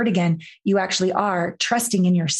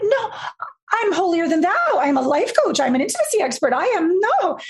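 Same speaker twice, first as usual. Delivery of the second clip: much higher, hammed-up self-righteous protest; mock indignant denial; 10 semitones higher than usual. Protesting to her coach, high hammed-up indignation. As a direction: play the offended know-it-all, pitched up.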